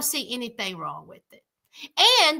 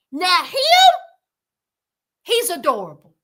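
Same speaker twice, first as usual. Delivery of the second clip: ordinary talk, nothing marked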